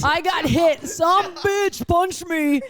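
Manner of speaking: loud, high-pitched blurt, played up for laughs; mock outraged yell. Friend tells co-host she got hit, mock outraged yell. Mock outburst after "Sorry, what?.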